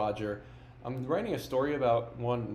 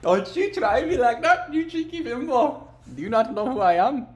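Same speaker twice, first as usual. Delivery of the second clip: much higher, slow, louder put-on Indian accent; 12 semitones higher, 5 dB louder than usual. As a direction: scold them in a hammed-up Indian accent. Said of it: "that's fucked up to do an Indian accent".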